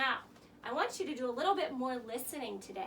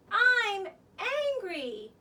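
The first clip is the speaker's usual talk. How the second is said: slow, stamped-out mock anger, a bit louder; mock angry; 6 semitones higher, 9 dB louder, much slower than usual. Shown as a demonstration. Teacher showing kids how to say they're angry, slow and firm. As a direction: stomp out each word like a cross child. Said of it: "tell someone".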